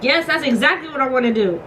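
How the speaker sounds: loud, high, then laughing; excited. Real, not acted. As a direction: burst out with glee.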